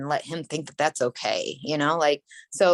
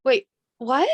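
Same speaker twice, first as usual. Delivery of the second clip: high, louder stunned wait what; retold shock, higher and louder; shocked disbelief; 9 semitones higher, 5 dB louder than usual. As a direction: blurt it as the news sinks in. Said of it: "I instantly just in shock".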